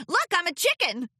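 high, loud giggly filly; playful filly. Acted. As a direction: show off a silly costume. Filly playing chicken.

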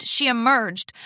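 ordinary delivery

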